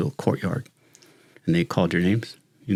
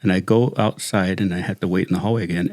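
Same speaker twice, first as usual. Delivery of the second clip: ordinary talk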